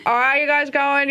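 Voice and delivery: put-on drawn-out broad Aussie accent; Dan Towns impression. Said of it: "he's really Ocker. He's really Aussie sounding".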